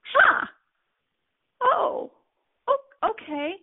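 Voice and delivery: high, baffled huh, then a hesitant okay; stunned. Retold or imitated. Left alone after the woman walks away, stunned and baffled. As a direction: caught off guard, stammer out okay. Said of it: "I'm like".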